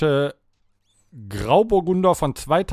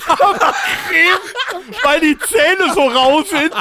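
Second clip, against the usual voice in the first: laughing; 11 semitones higher, slower than usual. Real, not acted. Laughing at the joke.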